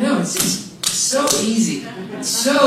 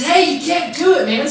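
mock scolding; 8 semitones higher, 5 dB louder than usual. The heart's voice scolding himself.

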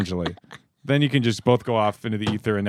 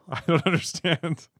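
usual speaking voice

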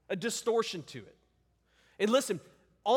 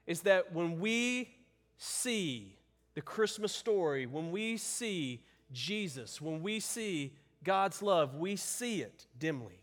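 slow, soft emphasis; 3 semitones lower, 3 dB quieter, slower than usual. Real, not acted. Pastor slows to drive point home.

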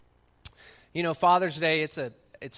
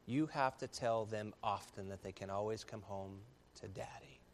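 normal talking voice

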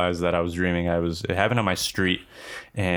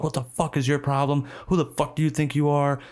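higher-pitched mock yell, no real volume; indignant rant, acted at talking level; retold screaming; 6 semitones higher than usual. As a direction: imitate an irate neighbor telling someone off. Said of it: "outside just screaming at him".

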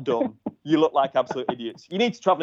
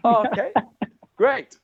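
high-pitched, put-on agreement; retold forced acceptance, pitched up high; mock compliance; 9 semitones higher than usual. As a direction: give in with a high, mock-cheerful okay. Retold meek reply to the boss.

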